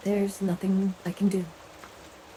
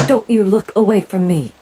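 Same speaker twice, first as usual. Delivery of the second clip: louder, cutting order; sharp angry command; 3 semitones higher, 9 dB louder than usual. Acted. Leader to the silent idol, a sudden angry command. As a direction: snap at them to hold your gaze.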